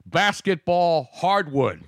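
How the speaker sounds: slow mock news bulletin with pauses; news announcer. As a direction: announce it like a TV anchor.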